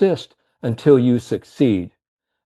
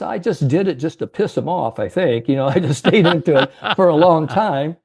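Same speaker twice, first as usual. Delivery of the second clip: lightly voiced old man, higher, with a chuckle; a hint of the old man, amused at himself; old salesman's voice; 5 semitones higher than usual. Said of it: "how he talked to".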